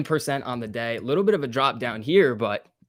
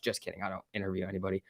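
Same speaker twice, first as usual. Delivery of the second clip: quiet aside; 6 semitones lower, 11 dB quieter, much faster than usual. Real, not acted.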